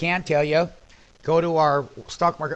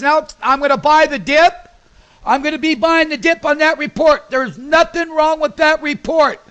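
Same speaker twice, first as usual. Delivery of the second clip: loud, high trading-floor calls; urgent, pumped live trading; excited; 8 semitones higher, 5 dB louder than usual. Real, not acted. Trader shouting his fills to Ray Ray, excited.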